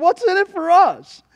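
high, loud demand; Peter's bold question. Acted. Disciple asking Jesus for his share, bold and loud. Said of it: "in other words".

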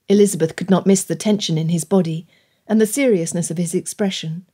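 ordinary delivery, nothing marked

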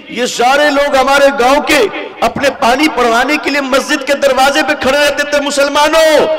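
loud, high sermon voice; impassioned preacher; fiery preaching. Real, not acted. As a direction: rebuke the congregation at full force. Preaching at full force.

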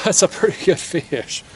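normal talking voice

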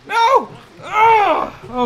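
loud high no; despairing shout. Real, not acted. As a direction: yell it as it all falls. Character falls, shouting at failure.

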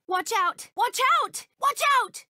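high loud shout of warning. Acted. Game character warning a teammate with a loud shout.

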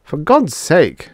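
loud, high, drawn-out urging; urgent plea. A narrator's reading. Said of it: "urged Drummond".

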